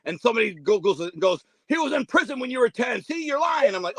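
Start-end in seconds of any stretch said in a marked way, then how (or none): 1.7-3.8 high, pitched-up accusing yell; imitating an angry accuser; mock accusing shout. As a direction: throw the accusation at him, higher. Heated accusation quoted in story.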